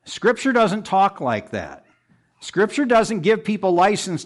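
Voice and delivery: preacher correcting a false excuse, emphatic; emphatic rebuke. Real, not acted. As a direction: pound the point home from the pulpit.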